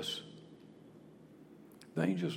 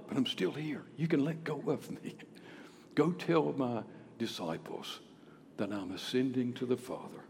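gentle reassurance; 4 semitones lower, 8 dB quieter than usual. Acted. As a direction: reassure her quietly. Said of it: "He was saying".

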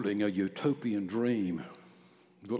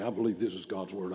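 reluctant recital; 2 semitones lower, faster than usual. Retold or imitated. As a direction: rattle it off as a wary churchgoer declining to say it. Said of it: "I'm not going out and say it just like that".

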